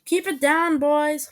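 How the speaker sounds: mom calling from another room; high, loud parental call. A narrator's reading. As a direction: call out to noisy kids. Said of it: "Mom called from the other room".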